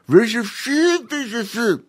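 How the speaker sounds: high chimp mumbling with a mouthful; mumbled through brush. A narrator's reading. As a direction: talk with a brush in your teeth. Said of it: "mumbled Cheemy".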